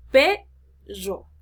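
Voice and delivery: "Perro" split as PEEE... rro, first syllable loud and rising; syllable-by-syllable stress. Shown as a demonstration. Teacher demonstrating prosodic accent, PE of "Perro" stressed. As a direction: punch and stretch PE in "Perro", then drop on rro. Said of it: "se tiene que pronunciar".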